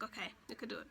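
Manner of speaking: soft little pep talk to herself; quiet self-talk. Retold or imitated. Telling herself before opening the college email, quiet self-encouragement. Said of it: "I just told myself".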